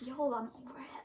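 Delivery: breathy casual whisper. Retold or imitated. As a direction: toss it off in a whisper. Little cousin brushing it off.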